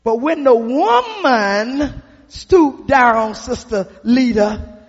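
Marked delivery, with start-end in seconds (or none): none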